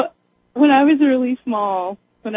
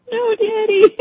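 high imitated little-girl answer; retold child voice, pitched up; chastened child; 9 semitones higher, slower than usual. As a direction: play a small kid who has learned the lesson. Child answering dad in her story.

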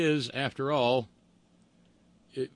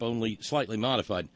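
usual speaking voice

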